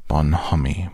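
ordinary delivery, nothing marked

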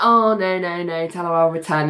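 low, understated letdown on the phone; disappointed. Retold or imitated. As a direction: let the voice drop, deflated. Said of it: "And then she's disappointed".

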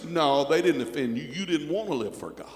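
ordinary delivery

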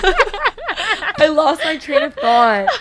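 laughing through the words; breaking up with laughter. Real, not acted. Giggling fit during blooper.

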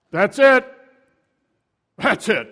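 loud, high acted exclamation; triumphant outburst. Council seizing on Jesus's answer, triumphant outburst. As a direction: pounce on the words loudly.

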